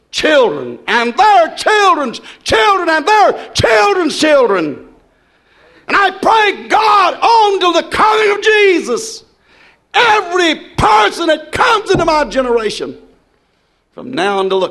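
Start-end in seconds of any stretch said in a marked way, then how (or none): 1.2-13.0 fervent shouted prayer. Real, not acted. Fervent prayer building in sermon.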